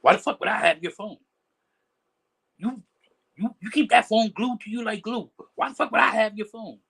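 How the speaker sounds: mock outraged rant about a lost phone; mock angry reply. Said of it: "And they're like".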